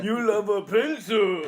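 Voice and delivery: slow, loud, high mock-Japanese bellow; hammed-up Japanese caricature. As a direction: stretch it out like a cartoon Japanese samurai. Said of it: "my racist Japanese voice sounds like".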